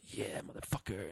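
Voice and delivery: quiet low badass line; mock badass heroine. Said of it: "And then she's like".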